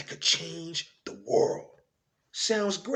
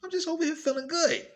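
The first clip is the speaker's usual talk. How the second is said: very high, happy quoted voice; carefree smiler; 13 semitones higher than usual. Acted. Voicing what a smile says to others, carefree. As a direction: lift it into a light, high-pitched voice. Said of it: "a universal symbol of people saying".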